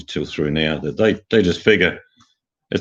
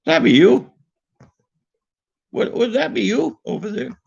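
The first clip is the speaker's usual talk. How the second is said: higher, lilting Irish accent rising at the end; 4 dB louder than usual. Shown as a demonstration. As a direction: put on a sing-song Irish lilt. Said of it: "talk Irish accent".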